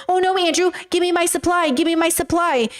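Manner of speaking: high whiny begging, hammed up; mock pleading women. Mock women begging Andrew for their supply, high and whiny. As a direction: beg Andrew for supply like a desperate fan. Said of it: "the women are gonna be like".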